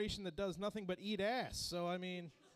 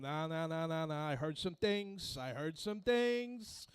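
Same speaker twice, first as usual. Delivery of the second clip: Robert De Niro impression; 4 dB louder than usual. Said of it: "Robert De Niro would then come in the room".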